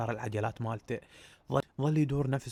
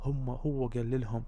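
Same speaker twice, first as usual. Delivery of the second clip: ordinary delivery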